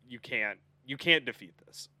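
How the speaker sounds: ordinary talk, nothing marked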